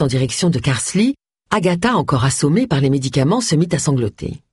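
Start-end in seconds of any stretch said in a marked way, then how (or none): none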